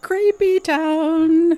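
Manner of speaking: high, drawn-out title call; hammed-up segment announcement. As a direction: stretch the title out theatrically, high and loud.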